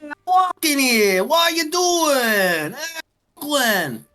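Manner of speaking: high, drawn-out fake Brooklyn accent; hammed-up New York accent, vowels stretched long; mock New York accent. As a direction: exaggerate a New Yorker's accent, slow and high. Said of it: "walk up to some random New Yorker and go".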